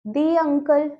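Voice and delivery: long ee in "the uncle"; "the" as dee. Shown as a demonstration. Teacher giving a vowel example, "the" as "dee". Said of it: "the का use करेंगे".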